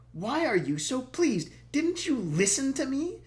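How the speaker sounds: high, loud, slow contempt; disgusted. Acted. The prince scorns the pleased king, disgusted. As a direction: sneer it at the foolish king. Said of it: "said disgustedly".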